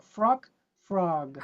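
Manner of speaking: "Frog, frog": first clipped to frock, then o stretched; short vowel first, long vowel second; contrast frock then frog. Shown as a demonstration. Teacher models frock versus frog for students. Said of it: "extend the vowel and say frog".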